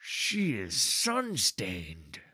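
very slow, drawn-out sneer; sneering guard. A narrator's reading. Second guard judging an outsider at the door, sneering. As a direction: stretch the words with contempt. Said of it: "lifting his chin with a sneer".

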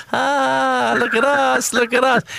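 high mock boast, crowing like a smug winner; smug gloating. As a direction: imitate a grinning champion showing off. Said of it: "just smiling, saying".

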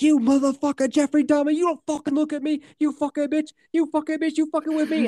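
high, raging scream of a grieving relative; furious screaming. Retold or imitated. Victim's relative screams at Dahmer in court, high furious yell. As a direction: scream it at him, out of control. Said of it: "One of them was like".

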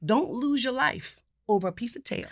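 ordinary delivery

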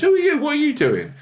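squeaky, very high little fruit voice; talking apple. Retold or imitated. Apple runs up to a stranded astronaut, squeaky and very high. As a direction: pitch way up like a tiny cartoon apple. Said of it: "An apple runs up to me and goes".

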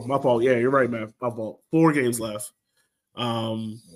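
usual speaking voice

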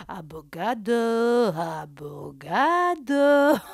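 lampoon a posturing guy, dragging each syllable; mock pretentious drawl. Mocking ex's old catchphrase.

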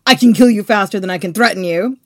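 loud, fast threat; brisk, forceful warning to a treasurer; Caesar threatening. Acted. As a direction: fire off the threat loudly. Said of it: "telling the treasurer who tried to stop him".

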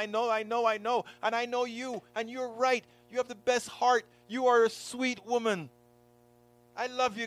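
high, gentle comforting; comforting the crying friend, tender; reassuring. Retold or imitated. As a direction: soothe her, then press her.

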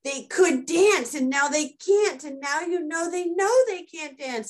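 high, slow, half-hammed teenage despair; retold teen sobbing over a movie ending; mock tearful wail. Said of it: "I'm like".

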